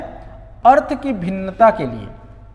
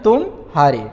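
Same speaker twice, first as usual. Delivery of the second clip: तुम्हारे broken into तुम, short gap, हारे; pause inside word; 6 semitones lower, slower than usual. Shown as a demonstration. Teacher contrasting the meaning, तुम and हारे said as two words. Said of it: "विराम है वो थोड़ा बड़ा है".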